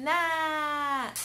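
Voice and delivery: NA with a long held N sliding into a long A; nnnaaa, sounded out slowly; blending letter sounds. Shown as a demonstration. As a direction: hum the N, then open to "Na". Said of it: "vamos falar o sonzinho da letrinha N".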